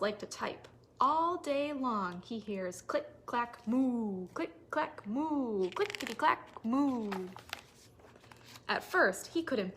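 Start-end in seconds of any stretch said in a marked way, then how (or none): 2.8-7.1 rhythmic typing chant. A narrator's reading. Cows typing sound chant.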